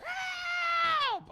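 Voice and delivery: high piercing cry for help; retold scream. Son waking suddenly, screaming for help. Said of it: "he screams out of nowhere".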